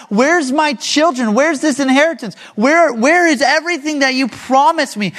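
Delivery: high, urgent run of questions; struggling complaint to God. Acted. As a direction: cry out to God in frustrated protest. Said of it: "He's struggling with God".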